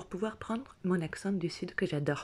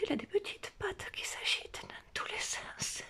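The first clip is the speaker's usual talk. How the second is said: high, soft creeped-out whisper; horrified whisper; 13 semitones higher, 7 dB quieter than usual. Retold or imitated. Feeling legs under her fingers, high horrified whisper. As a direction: breathe it out in horror. Discovering the tick's moving legs.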